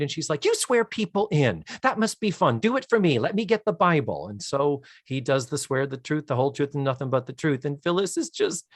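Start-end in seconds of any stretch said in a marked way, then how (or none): 0.4-4.3 high, eager pleading; delighted hostess. Retold or imitated. Hostess begging the clerk to swear her in, delighted and eager. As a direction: get carried away with excited enthusiasm.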